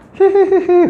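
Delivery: high, shrill-pitched possessed cackle; demonic giggle. Retold or imitated. Possessed woman giggling at the young preacher, eerie cackle. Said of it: "진짜 귀신의 목소리가 나오더라는".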